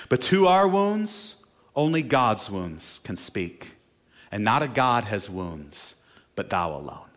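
a pastor reciting a war poem to the congregation, solemn; poem reading. Acted. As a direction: read it like a poem, letting each line land. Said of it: "it ends like this".

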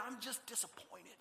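suddenly hushed parental letdown; disappointed dad. Acted. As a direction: drop to a soft, wounded dad voice. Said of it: "Like your dad did when you lied".

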